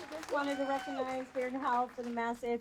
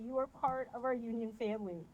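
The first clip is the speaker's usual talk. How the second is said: ordinary delivery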